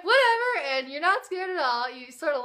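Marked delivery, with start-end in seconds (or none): 0.0-0.7 high, loud careless whatever; mock brushing-off of horror; dismissive. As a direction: toss it off like nothing scares you. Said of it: "And then you're like".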